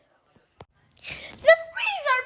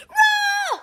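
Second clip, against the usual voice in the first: mock roar shouted out; pretend monster roar; 8 semitones higher than usual. Child roaring at toys in pretend play, mock monster roar.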